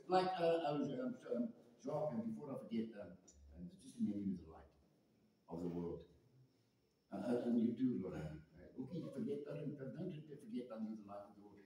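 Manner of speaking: soft, low, hesitant muttering full of ums; passive mumbling. Shown as a demonstration. Preacher parodies the Lord saying it passively, mumbling and faltering. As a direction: mumble it offhand, barely audible, like an afterthought. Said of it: "He didn't say it like that".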